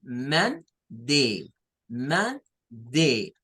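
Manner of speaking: "Man de" split in two parts; spelled-out Monday parts; syllable by syllable. Shown as a demonstration. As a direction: build up "Man de" slowly. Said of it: "vamos a decir man".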